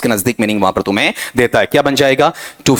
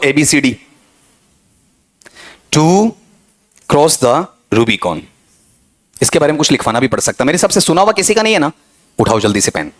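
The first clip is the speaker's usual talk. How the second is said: usual speaking voice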